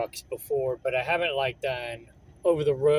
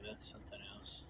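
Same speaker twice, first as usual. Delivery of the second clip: whispered aside; 7 semitones higher, 19 dB quieter, faster than usual. Real, not acted. Reacting to low pay offer.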